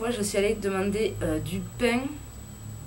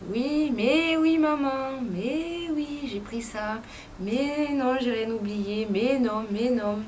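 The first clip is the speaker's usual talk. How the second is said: exasperated daughter; 3 semitones higher, slower than usual. Retold or imitated. Daughter on the phone reassuring her mother, exasperated drawn-out sighs. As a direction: brush off a nagging mother, slowly and wearily. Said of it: "au téléphone, euh, quand il y a ma mère".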